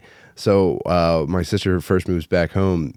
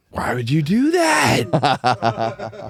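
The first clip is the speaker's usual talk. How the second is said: drawn-out, higher-pitched mock protest; mock outrage; 6 semitones higher, much slower than usual. Reacting to a room filled with fart smell, mock outrage. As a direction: play up the appalled complaint, slowly. Acted outraged complaint about farting.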